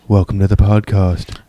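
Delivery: low, slow monotone radio voice; deadpan slang in a public-radio voice; mock NPR host. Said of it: "We're gonna start from scratch".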